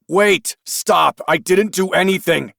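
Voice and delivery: high, loud panicked plea; panicked protest. Acted. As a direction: beg them to stop. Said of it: "Craig protested".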